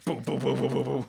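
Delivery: mock six-shooter blasts; gunshot noises. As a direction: fire off six pretend shots. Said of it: "gets, like, a six shooter".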